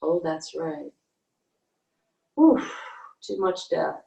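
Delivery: ordinary talk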